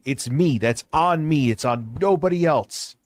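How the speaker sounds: ordinary talk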